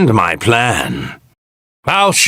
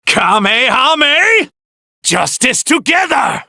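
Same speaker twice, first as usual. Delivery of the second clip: battle cry; 13 semitones higher than usual. Acted. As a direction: yell the attack name with full force. Battle cry during attack.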